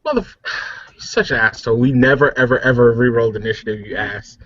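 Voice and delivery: usual speaking voice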